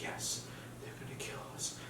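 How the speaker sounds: barely audible whisper; hushed, imitating her grave answer; whispered confirmation. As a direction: whisper it like a dire secret.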